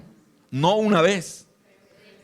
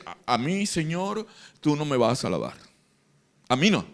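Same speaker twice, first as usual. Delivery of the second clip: hushed Peter refusing; 3 semitones lower, 6 dB quieter than usual. Retold or imitated. Peter refusing foot washing.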